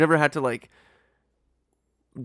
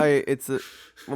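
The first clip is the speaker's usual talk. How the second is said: usual speaking voice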